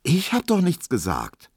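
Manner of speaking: high, pleading denial; flustered protest. A narrator's reading. Emil denies having said anything, flustered. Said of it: "stotterte Emil Kubinke".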